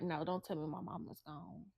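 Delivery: soft, low retold plea; dreading plea. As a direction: say it hushed, bracing for bad news. Father dreading news of his mother.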